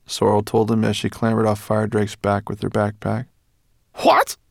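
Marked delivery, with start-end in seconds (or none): 4.0-4.4 sharp high startled cry; shocked yelp of alarm. A narrator's reading. Professor hearing about the giant bird, alarmed. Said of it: "looked up in alarm".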